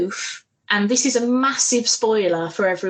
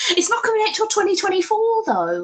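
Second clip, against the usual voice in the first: real gushing fan excitement, pitch jumping high; excited; 5 semitones higher than usual. Real, not acted. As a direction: rant with overexcited mock outrage at the wait. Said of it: "I'm so, I'm excited. I'm sorry".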